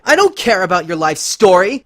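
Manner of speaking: high, loud raging outburst; furious cry. Acted. Twilight cutting off Lyra's story, raging cry. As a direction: cut her off in rage. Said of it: "cried Twilight".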